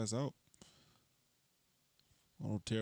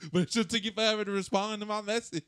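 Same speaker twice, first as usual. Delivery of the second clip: normal talking voice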